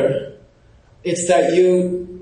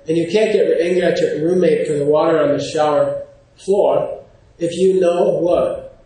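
usual speaking voice

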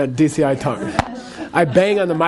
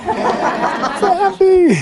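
very high, long-drawn squeal of joy; happy lady; 13 semitones higher, much slower than usual. Acted. Teacher voicing the lady reaching nirvana, blissfully happy squeal. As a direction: stretch it out high like an overjoyed lady. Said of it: "it's this happy lady".

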